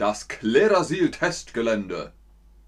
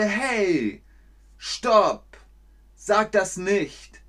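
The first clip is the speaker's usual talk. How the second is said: protest; 5 semitones higher, much slower than usual. Shown as a demonstration. Protesting an insult.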